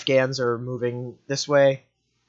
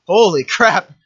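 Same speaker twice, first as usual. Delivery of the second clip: surprised shout; 6 semitones higher, 9 dB louder, slower than usual. Real, not acted. Sudden battle destruction.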